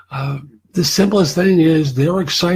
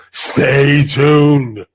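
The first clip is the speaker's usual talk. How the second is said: mock hyped recruiter; 4 dB louder than usual. Voicing recruiter's upbeat message.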